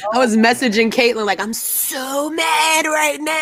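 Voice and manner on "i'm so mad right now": high, slow, drawn-out mock outrage; retold anger. Messaging Caitlin about the insult, high slow mock fury. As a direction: stretch each word up high in disbelief.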